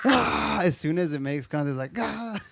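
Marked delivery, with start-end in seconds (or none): 0.0-0.6 high-pitched imitated yelp; mock Cyclops scream. Echoing the co-host's Cyclops, high mock scream. 2.0-2.4 high imitated scream; sudden high yelp on impact; mock pained yelp. Cyclops as the arrow makes contact, high mock yelp.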